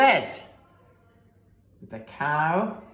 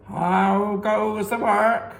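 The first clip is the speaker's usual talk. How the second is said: calling farmer; 3 semitones higher, 6 dB louder, slower than usual. Acted. Farmer calls again.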